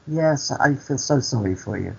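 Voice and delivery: soft mock-sorrowful voice; imitated pity. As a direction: imitate her pitying him, gently. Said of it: "you sound like you're upset".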